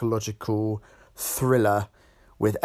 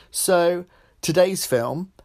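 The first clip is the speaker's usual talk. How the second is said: ordinary delivery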